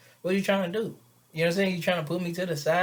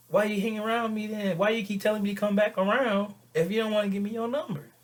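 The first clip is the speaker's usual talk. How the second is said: higher-pitched exasperated questioning; 6 semitones higher than usual. Acted. Man challenging a woman who withholds her number, exasperated. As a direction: press for an answer, mildly put out. Acted pushback over a number.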